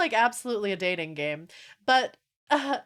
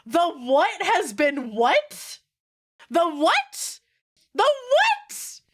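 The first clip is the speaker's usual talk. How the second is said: rising, stunned disbelief, climbing much higher; 7 semitones higher, 4 dB louder, much slower than usual. Retold or imitated. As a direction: repeat it louder each time, like you can't believe it. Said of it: "And I'm like".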